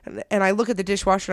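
usual speaking voice

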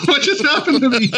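laughing. Real, not acted. Cracking up at own reaction.

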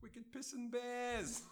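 put-on high, slow Boston accent broken by laughter; laughing Boston accent. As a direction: giggle through a hammed-up Boston accent. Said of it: "the Boston accent".

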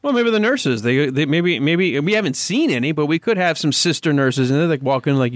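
normal talking voice

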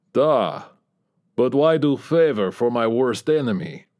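put-on grim Russian accent, broken English; mock the enemy in heavy Russian; Ivor's Russian accent. A narrator's reading. Ivor taunts his worst enemy Bob, in heavy Russian accent.

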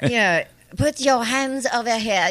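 high, exaggerated effeminate caricature voice.

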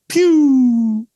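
loud imitated rocket launch, drawn out; long high pew. Storyteller mimics himself taking off over coals, long loud pew. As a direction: let a high pew fly like a take-off.

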